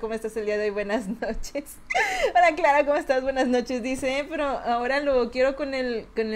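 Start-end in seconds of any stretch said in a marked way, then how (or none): 1.8-2.3 laughing. Real, not acted. Laughing at chat.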